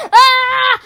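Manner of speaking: loud high fright; frightened cry. Acted. Boy as the door bursts open, frightened yelp.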